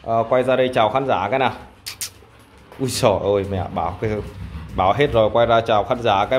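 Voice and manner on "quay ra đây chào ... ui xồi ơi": usual speaking voice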